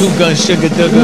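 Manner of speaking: loud, pumped-up podcast intro call; hype roll call. Acted. Host hypes the crew in the opening roll call, loud and pumped.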